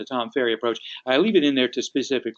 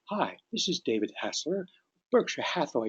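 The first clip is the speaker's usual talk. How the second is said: softer, unhurried phone introduction; matched easy pace; 8 dB quieter than usual. Shown as a demonstration. Agent matching a Southerner's pace, soft and easy. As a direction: introduce yourself gently, taking your time. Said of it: "equal their pace".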